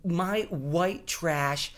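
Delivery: normal talking voice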